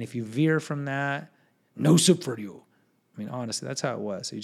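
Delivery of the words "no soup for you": loud, curt mock refusal; quick, forceful catchphrase impression; Soup Nazi. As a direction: lightly imitate a strict sitcom soup seller. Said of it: "It's like Soup Nazi from Seinfeld".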